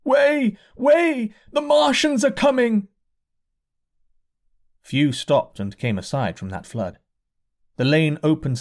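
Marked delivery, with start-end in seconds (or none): 0.0-2.9 high, loud, urgent calling out; panicked crowd cry. A narrator's reading. Fleeing crowd calls the refrain, high panicked cry. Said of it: "Through it all ran a refrain".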